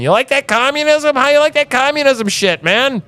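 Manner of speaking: high, taunting mock scream; mock yell. Host reenacting taunting his guest in a high mock scream. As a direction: jeer at him across the phone. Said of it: "I'm screaming at him across the phone".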